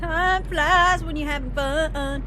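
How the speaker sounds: stretched out slow, "flies" drawn long; slow singsong. Pretend. Streamer drawls the saying to chat, slow and playful. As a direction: savor each word of "Time flies when you're having fun".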